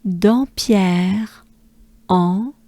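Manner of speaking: Dampierre, pause, nasal "en", both rising; French pronunciation. Shown as a demonstration. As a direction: model "Dampierre, en" for repetition. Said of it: "So we have".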